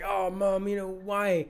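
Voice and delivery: high put-on daughter voice; voicing Anna. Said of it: "and she's like".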